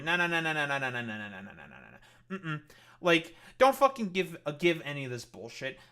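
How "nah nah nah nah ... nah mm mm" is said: dismissive nah-nah. Pretend. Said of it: "It's like".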